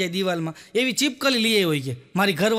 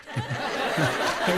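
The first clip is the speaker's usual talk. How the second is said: laugh; 6 semitones lower, 4 dB quieter than usual. Real, not acted. Laughs after punchline.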